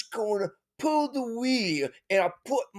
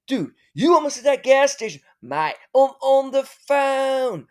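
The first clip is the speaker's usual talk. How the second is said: high, loud squabbling protest; acted exasperation; 6 semitones higher, 6 dB louder than usual. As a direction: protest loudly, dragging out the last words. Acted squabble during a phone call.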